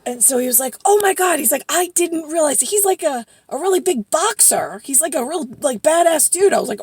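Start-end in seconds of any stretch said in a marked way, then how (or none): 0.8-1.4 high, loud retold gasp of realization; amazed. Retold friend's amazed reaction. 1.7-6.5 high, loud and impressed; retold amazement. Retold friend's amazed explanation.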